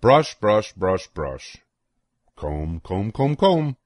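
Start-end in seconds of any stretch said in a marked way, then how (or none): none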